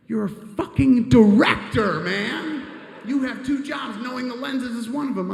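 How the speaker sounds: high, exasperated telling-off; yelling. Retold or imitated. Movie star berates director over lenses, yelling. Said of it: "Was he just yelling at you again".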